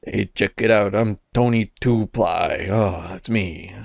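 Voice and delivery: low, slow, soft mascot voice; slow and low, a lazy mascot; Tony Two Ply impression. Showing off an impersonation to a friend, low and slow. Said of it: "my favorite impersonation thing to do".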